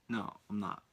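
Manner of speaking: ordinary talk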